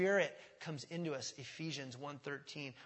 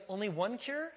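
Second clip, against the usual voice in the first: mock complaint; 5 semitones higher, 4 dB louder than usual. Acting out an objector to cure.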